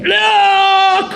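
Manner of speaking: outraged accuser. Retold or imitated. Townspeople confront Abraham, outraged high shout. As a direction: insist he see it. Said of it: "accusers repeat".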